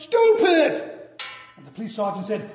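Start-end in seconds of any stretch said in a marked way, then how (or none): 0.0-0.9 much higher, loud yell of the name; shouted name. Acted. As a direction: yell your name to be heard. Shouting to sergeant.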